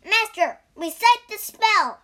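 high, loud urging; urgent shout. Acted. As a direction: call out a demand to the master. Pig urges the punishment.

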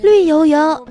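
high, loud put-on schoolkid voice; pupil answering. Second pupil answers the teacher, high mock-kid voice. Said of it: "小李也回答说".

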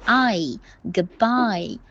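"I", "goodbye", "hi" slowly stretched to a final ee; full diphthong. Shown as a demonstration. Teacher modelling the sound for students to try, full diphthong. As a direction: let each of "I", "goodbye", "hi" glide out to ee. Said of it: "把一发出来".

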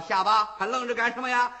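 loud urging. Acted. Wu Song urges gamblers on.

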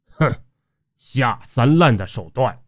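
contemptuous snort, then scornful words; cold snort. A narrator's reading. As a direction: scoff coldly at a dirty trick. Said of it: "韦大勇冷哼了一声". (冷哼一声，不屑地说)

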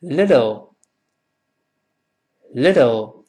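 "little" with the t voiced to a light d, "liddle"; softened t. Shown as a demonstration. Teacher models "little" for repeating, t voiced to d. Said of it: "读快了之后t".

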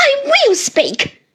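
high, loud, slow insistence; slow, ringing, angry declaration; furious defiance. A narrator's reading. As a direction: refuse to be silenced, forcefully. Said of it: "turning on me with her lightning eyes".

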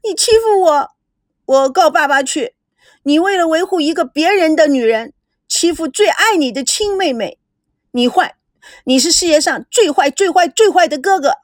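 put-on high, wailing childish protest; a spoiled little sister wailing; tearful tantrum. A narrator's reading. Spoiled sister wails at her brother, mock tearful tantrum. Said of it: "大哭起来".

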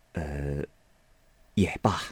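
low, soft hesitant "uh" before conceding; pondering. A narrator's reading. Lady Wang deciding on the allowance, quietly pondering. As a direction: give in quietly after a pause. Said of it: "又想了一想".

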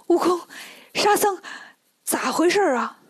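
high, startled call to companions; urgent calling out. Acted. Tang Monk waking to find house gone, calling urgently. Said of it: "唐僧就赶紧喊呢".

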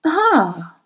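sighed revelation; great sigh. A narrator's reading. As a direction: let it out as a long-awaited "ah". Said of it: "she breathed a great sigh".

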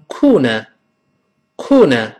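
corner in British style, no R; British pronunciation. Shown as a demonstration. Teacher shows how British people say it, British accent. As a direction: say corner British, open endings without curled tongue. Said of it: "英国人读成corner".

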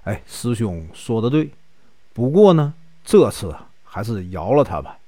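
low, soft coaxing; placating. A narrator's reading. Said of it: "只好劝他说".

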